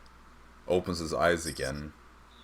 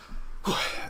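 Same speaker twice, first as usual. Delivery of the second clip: breathy exhale to himself. Acted. Eli sighs before leaving room.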